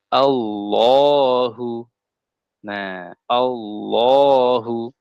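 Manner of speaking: Allahu drawn out very slowly with long a; long vowel. Shown as a demonstration. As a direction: stretch the first a in Allahu. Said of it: "cara bacanya dipanjangkan". (Allahu dibaca panjang dan pelan)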